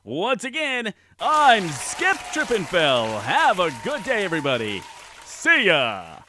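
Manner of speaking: cheesy TV host sign-off; game show host. Acted. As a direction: wrap it up like a slick host.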